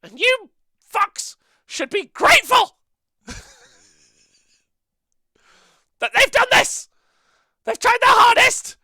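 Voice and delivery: very high squeaky puppet voice; Shilly voice. Acted. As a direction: shout it like a shrill little puppet. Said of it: "What does Shilly think of the app?".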